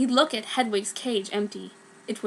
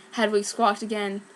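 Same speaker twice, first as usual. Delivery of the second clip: ordinary delivery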